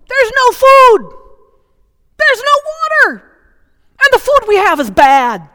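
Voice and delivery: high, loud, whiny wail; mock the Israelites' petulant whining; grumbling complaint. Preacher mimicking Israelites grumbling at God, high whiny wail. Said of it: "they were grumbling".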